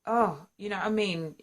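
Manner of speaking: ordinary talk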